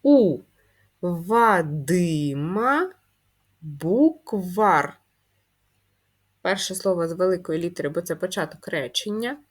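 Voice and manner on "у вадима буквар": very slowly read sentence, word by word; teacher reading a sentence to first graders. Shown as a demonstration. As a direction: stretch Вадима out while reading aloud. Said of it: "Далі в нас речення".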